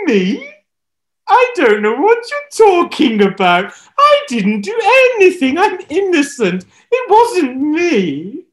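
very high, loud, pleading innocence; whiny denial. Acted. Ahab denying the killing to Elijah, whiny. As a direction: whine like a child caught out.

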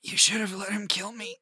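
high, strained voice of a beaten prisoner; weak prisoner pleading from the floor; dying beaten man. Acted. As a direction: force the words out through split lips. Beaten man speaks through bloodied lips.

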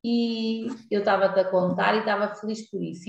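ordinary delivery

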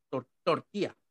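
tortilla said tor-ti-a, quick, with an i. Shown as a demonstration. Telling a friend how she says tortilla quickly, like tor-ti-a. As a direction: drop the y in tortilla, say tor-ti-a fast. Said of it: "la puse la I".

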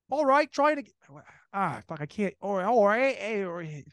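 high, halting attempt at British; failed British accent. Gary Oldman after the film ended, struggling British accent. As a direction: keep trying and failing at a British accent. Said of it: "to have a British accent".

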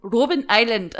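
laughing South African Afrikaans accent. Shown as a demonstration. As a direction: Robben Island with a hammed-up Afrikaans accent. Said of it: "Can you repeat that?".

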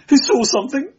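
high, shrill, hysterical cry. Acted. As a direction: shriek it in panic. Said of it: "voice shrilled and cracked with hysterical excitement".

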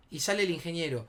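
usual speaking voice

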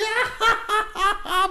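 high imitated cracking-up laugh; mocking cackle. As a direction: mimic a friend laughing uncontrollably. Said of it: "you're sitting there cracking up like".

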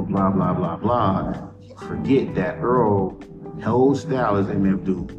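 normal talking voice

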